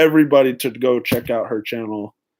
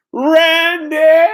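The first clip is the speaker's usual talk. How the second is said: higher, louder drawn-out name; excited call; 13 semitones higher, 6 dB louder than usual. Real, not acted. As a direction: sing out a welcome to Randy. Calling out viewer's name.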